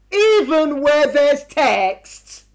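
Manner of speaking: very slow, each word weighed; slow emphatic. Shown as a demonstration. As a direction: press "even where there's text" slowly. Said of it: "repeated slowly for emphasis".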